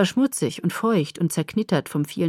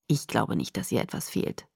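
low, soft, hushed doctor; muted; 9 semitones lower, 6 dB quieter than usual. A narrator's reading. Doctor aside to the worried father, muted. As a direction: keep it down, low and discreet. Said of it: "sagte er kurz darauf gedämpft".

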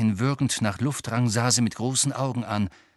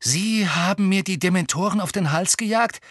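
higher, disbelieving; shocked Harry; 8 semitones higher, 3 dB louder than usual. A narrator's reading. Harry realizing Umbridge sent dementors, higher in shock. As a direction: ask in shock.

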